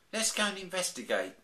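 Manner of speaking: high, eager little cub; a child bear keen for adventure; excited. Acted. Baby Bear urging the family upstairs, high and excited. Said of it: "said Baby Bear excitedly".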